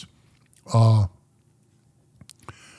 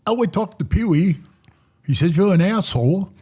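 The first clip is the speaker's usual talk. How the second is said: lightly imitated London accent, pitched higher; British accent; 6 semitones higher, 3 dB louder than usual. Interviewee quotes the London TV guy, light British accent. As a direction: retell it as the British TV narrator, higher. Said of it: "he's, he's British, you know".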